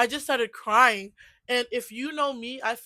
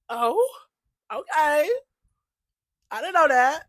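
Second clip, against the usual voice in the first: higher, louder playful act of being taken aback; mock surprise; 6 semitones higher, 6 dB louder than usual. Vlogger retells learning of naughty and nice list, mock surprised. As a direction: act out finding out something new. Acting out own surprised reaction.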